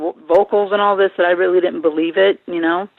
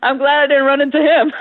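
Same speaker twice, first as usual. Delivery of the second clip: laughing; 7 semitones higher, 3 dB louder than usual. Real, not acted. Laughing remark.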